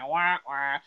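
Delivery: mock sad trombone wah wah. Narrating Alan showing up, mock sad trombone. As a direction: voice a deflating wah wah. Mocking disappointment at Alan arriving.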